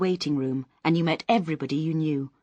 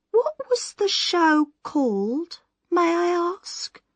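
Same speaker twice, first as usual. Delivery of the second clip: higher, slow, carefully polite; feigned casual interest; 14 semitones higher, 5 dB louder, slower than usual. Acted. Miss Moss asks the sad girl about the show, a high slow polite voice. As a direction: pretend not to care while prying.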